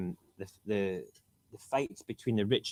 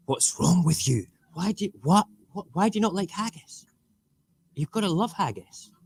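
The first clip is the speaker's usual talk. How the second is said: mock outrage; 8 semitones higher than usual. Mock outrage at haggis haters.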